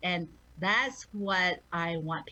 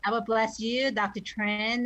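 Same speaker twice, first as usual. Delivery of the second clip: ordinary talk, nothing marked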